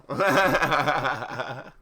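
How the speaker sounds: real burst of laughter; long rapid giggling cackle; laughing. Real, not acted. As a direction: crack up at the absurd text. Laughs at the absurd text.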